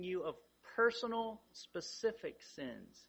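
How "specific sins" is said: usual speaking voice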